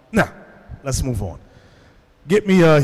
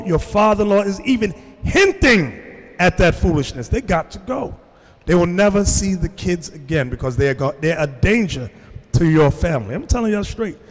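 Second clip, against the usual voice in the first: ordinary talk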